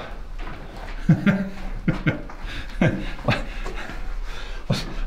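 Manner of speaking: long airy laugh, high; breathy unvoiced laugh. Real, not acted. Explorer laughing at a giant commode in the mine. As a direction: laugh silently at the absurd toilet.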